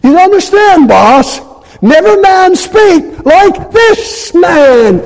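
high, loud, slow awestruck excuse; mock excuse to boss. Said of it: "try using that excuse".

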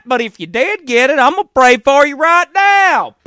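loud, high, drawn-out Southern drawl; mock Southern accent. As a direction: parody a Southern preacher, slow and loud. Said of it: "because she has a Southern accent".

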